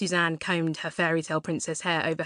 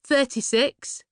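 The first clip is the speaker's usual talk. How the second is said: put-on a lightly raised, dry young woman; a touch higher, matter-of-fact; Laurie's voice; 8 semitones higher, 5 dB louder, slower than usual. Answering Suzanne's nosy question, lightly voiced and dry.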